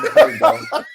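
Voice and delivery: words bubbling with laughter; laughing. Real, not acted. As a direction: say it while cracking up. Laughs at plane incident.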